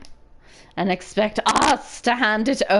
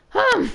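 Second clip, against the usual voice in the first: high rising hmm; suspicious hum; 4 dB louder than usual. Acted.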